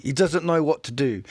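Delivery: normal talking voice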